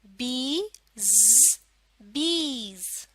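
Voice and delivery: बीज drawn out very slowly; slow reading demo; slow blending. Shown as a demonstration. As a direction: stretch बीज out. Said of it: "क्या लफ्ज है ये?".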